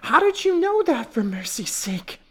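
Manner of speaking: loud, very high shrill astonishment; gasping. A narrator's reading. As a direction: gasp it as a shrill, amazed wife. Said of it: "Missus Potter gasped".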